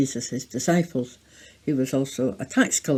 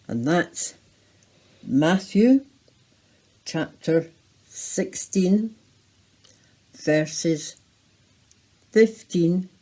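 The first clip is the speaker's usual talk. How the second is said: ordinary talk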